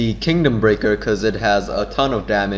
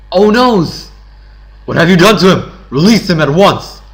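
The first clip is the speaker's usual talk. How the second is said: loud, high-pitched dramatic outcry; alarmed demand; 7 semitones higher, 9 dB louder than usual. Acted. Hero demanding the captor free his friend, loud outcry. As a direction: cry out in alarm, then order forcefully.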